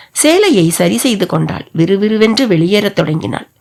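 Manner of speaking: plain reading voice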